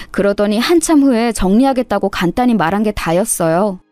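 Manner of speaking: usual speaking voice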